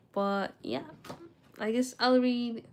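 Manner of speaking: normal talking voice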